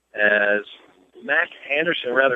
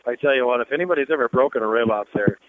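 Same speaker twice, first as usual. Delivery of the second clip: ordinary delivery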